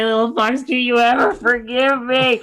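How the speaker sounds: loud, high mock-distraught plea; wailing apology. Player voices a character begging Little Fox's forgiveness, distraught wail.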